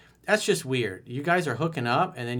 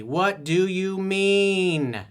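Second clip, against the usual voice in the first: drawn-out, higher, louder; slow emphatic demo; 7 semitones higher, 6 dB louder, much slower than usual. Shown as a demonstration. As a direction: stretch every word of it. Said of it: "How to communicate with women effectively".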